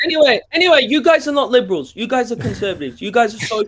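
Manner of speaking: emotional accusation, high and insistent; worked up. Real, not acted. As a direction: argue heatedly, voice climbing. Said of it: "why are you getting so emotional?".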